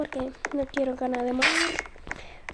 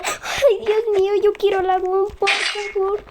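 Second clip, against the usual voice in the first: higher, louder pleading whine; 4 semitones higher, 4 dB louder than usual. Real, not acted. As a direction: beg for it, high and louder. Suplica en el juego, agitada. (súplica aguda y más fuerte)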